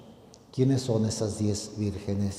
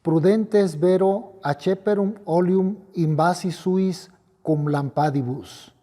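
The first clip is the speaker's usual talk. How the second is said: raised, solemn gospel proclamation; liturgical proclamation; 6 semitones higher, 5 dB louder than usual. Acted. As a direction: proclaim it like a priest at the altar. Latin gospel proclaimed at Mass.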